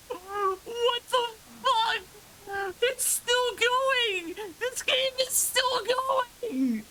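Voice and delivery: high, slow, incredulous cry; stunned disbelief. Real, not acted. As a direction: wail it out in shocked disbelief. Game won't end, stunned.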